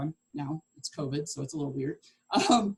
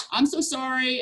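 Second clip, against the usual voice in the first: mock apology; 6 semitones higher, 4 dB louder than usual. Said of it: "you're like".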